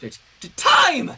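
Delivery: high loud stammered shout; sputtered shout. Acted. Sunset blurting at Twilight under pressure, loud sputter. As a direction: blurt it out in a panic. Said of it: "Sunset finally managed to sputter".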